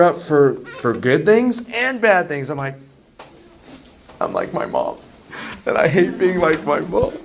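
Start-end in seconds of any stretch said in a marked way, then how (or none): 4.2-7.1 mock tearful. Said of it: "I get very emotional".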